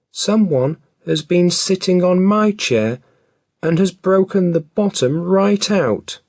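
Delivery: put-on little baby bear voice. A narrator's reading. Baby Bear upset about his broken chair, high and loud. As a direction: squeak it out like a tiny cub. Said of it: "in his little baby voice".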